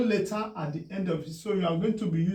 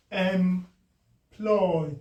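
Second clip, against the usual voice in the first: "Employ" as em, pause, ploy; split by syllable. Shown as a demonstration. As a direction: break "Employ" into two separate beats. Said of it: "Two syllable".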